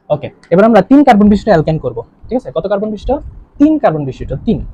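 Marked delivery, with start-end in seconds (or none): none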